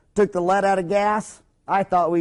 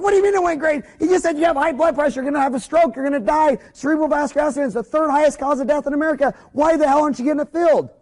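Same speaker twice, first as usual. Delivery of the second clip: much higher, loud, fast at the end; exasperated yelling; 8 semitones higher, 3 dB louder, faster than usual. Retold or imitated. Family scolding Grandpa over unfilled pills, exasperated yell. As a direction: scold him, boiling over.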